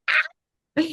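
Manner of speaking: very high little squeal of excitement; excited squeal. Acted. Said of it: "so excited".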